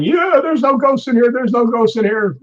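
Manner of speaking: mock-shout a cocky kid's dare; yelling. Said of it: "he's yelling".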